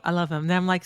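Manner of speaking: ordinary talk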